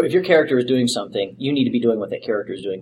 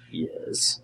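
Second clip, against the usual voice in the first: soft, whispered admission; whispered reply; 10 dB quieter than usual. Retold or imitated. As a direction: whisper it as a retold answer. Acting out sheepish answer to fans.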